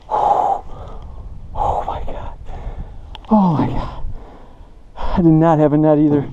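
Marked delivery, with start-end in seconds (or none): 0.1-4.0 whispered, spent exhalation; breathless awe. Real, not acted. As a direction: breathe it out in stunned relief. Winded and stunned after catch.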